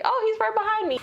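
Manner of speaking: high gleeful gremlin; mock gremlin. Gremlin taunting the dad, high squeak.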